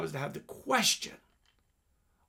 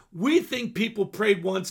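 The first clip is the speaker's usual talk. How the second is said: usual speaking voice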